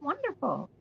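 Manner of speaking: high, soft, delighted; delighted praise. Retold or imitated. Yvonne praising the young man's course, high and soft. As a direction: praise him softly and warmly. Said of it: "and she said".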